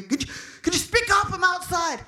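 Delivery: high, loud demand over noise; mock impatient shout; irritated cop. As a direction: imitate an officer yelling to be heard. Irritated cop demands she speak up.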